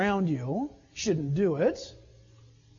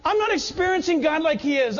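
complaining to wife; 5 semitones higher than usual. Retold or imitated. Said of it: "I'd go home and".

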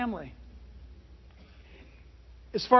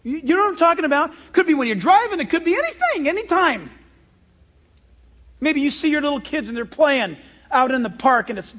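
ordinary talk, nothing marked

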